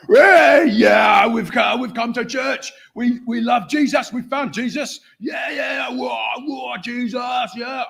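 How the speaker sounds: bursting into church like rowdy converts; rowdy punk excitement. Retold or imitated. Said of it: "and they're like".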